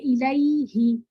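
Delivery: الیہ recited slowly, each syllable held; drawn-out tajweed reading of الیہ; Quranic recitation. Shown as a demonstration. Teacher recites an example for the students, slowly. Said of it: "یا ہی ساکنہ ہو".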